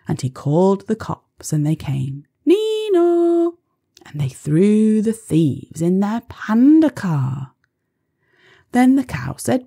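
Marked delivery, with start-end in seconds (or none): 2.4-3.6 high police siren call; siren sound. Pretend. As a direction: sing it like a siren.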